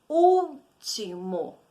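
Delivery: "Último" drawn out slowly as úl, ti, mo; teacher splitting "Último" into slow, separate syllables. Shown as a demonstration. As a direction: sound out "Último" in three long beats, strongest on úl. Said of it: "Tem três sílabas".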